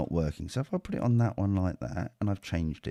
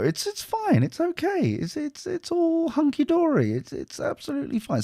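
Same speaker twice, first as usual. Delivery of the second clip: mock reassurance. Sarcastic reassurance after mocking dude.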